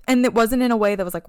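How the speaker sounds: usual speaking voice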